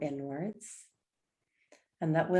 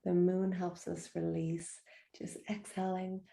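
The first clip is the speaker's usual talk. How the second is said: usual speaking voice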